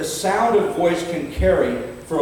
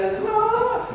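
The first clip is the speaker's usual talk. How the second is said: sudden high mock cry of frustration; imitated tantrum shriek. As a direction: yelp like a child in a tantrum. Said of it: "they roll on the ground".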